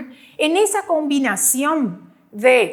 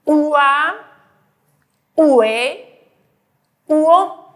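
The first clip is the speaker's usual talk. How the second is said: loud spelled-out vowels; 3 semitones higher, 5 dB louder, slower than usual. Shown as a demonstration. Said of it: "Y la otra combinación".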